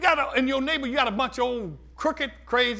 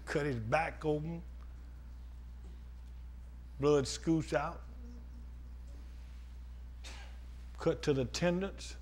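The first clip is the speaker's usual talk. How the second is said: soft solemn preaching; 8 semitones lower, 8 dB quieter than usual. Acted. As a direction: bring them quietly to the cross. Soft preaching over music.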